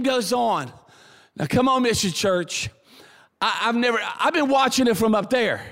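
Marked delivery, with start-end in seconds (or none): none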